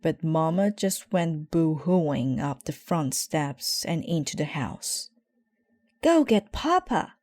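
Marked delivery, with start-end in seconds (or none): 6.0-7.1 urgent. Acted. Sister urgent order.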